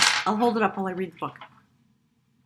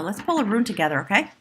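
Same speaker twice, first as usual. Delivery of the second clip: ordinary talk, nothing marked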